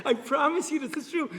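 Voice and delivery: high, loud laughing vow. Real, not acted. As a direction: laugh through the promise. Laughing at own story.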